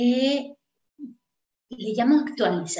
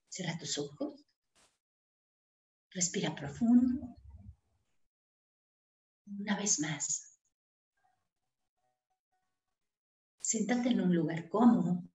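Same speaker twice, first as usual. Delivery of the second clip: guided meditation; 3 semitones lower than usual. Acted. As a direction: lead them gently into calm breathing.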